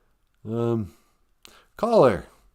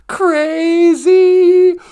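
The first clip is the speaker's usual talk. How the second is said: mock crazy voice; 8 dB louder, much slower than usual.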